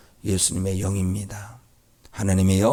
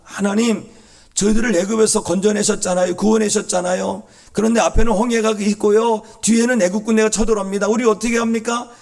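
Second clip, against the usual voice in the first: loud, high desperate plea; pressing, high-pitched appeal; urgent prayer; 11 semitones higher, 5 dB louder than usual. Acted. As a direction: cry out to God for rescue. Models desperate prayer at the sea.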